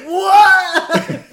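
very high, loud yelp through laughter; hammed-up terrified cry; mock terrified. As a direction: shriek in comic horror. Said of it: "He's just terrified".

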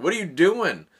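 loud retold yell. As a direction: call out loud and sharp. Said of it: "some guy yelling".

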